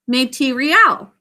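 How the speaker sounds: "Material" said as may-tee-ree-al, every vowel full; "Material" with a clear MAY and AL; spelling pronunciation. Shown as a demonstration. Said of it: "the way we think to spell it".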